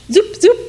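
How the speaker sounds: high zoop, zoop wand effect; magic zoop sound. A narrator's reading. Father's wand making things vanish, high zoop. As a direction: make the wand sing zoop.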